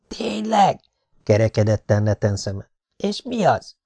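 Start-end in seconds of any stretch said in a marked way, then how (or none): 0.1-0.8 put-on surprised kid. A narrator's reading. Nathan surprised. 3.0-3.7 put-on eager kid. A narrator's reading. Nathan curious.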